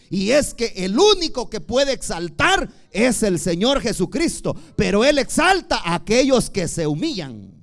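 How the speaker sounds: loud, ringing preacher proclamation; full-force preaching. Acted. Pastor to the brothers, preaching at full force.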